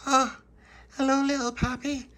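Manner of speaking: very high, loud, squeaky hello; girl character greeting. A narrator's reading. Dolores on her step greeting Little Pappy, very high.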